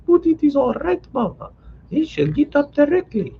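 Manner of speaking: high, light reassuring daughter; calm mama down, pitched high; young wife. A narrator's reading. Liza calms her mother.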